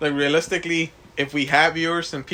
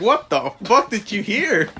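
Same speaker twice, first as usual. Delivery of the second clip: laughing disbelief; 6 semitones higher, 4 dB louder than usual. Retold or imitated. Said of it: "me, I was like".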